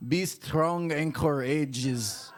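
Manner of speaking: booming, drawn-out fake Russian accent. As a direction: say it slow and loud like a hammy Russian. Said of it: "if you have an accent or something".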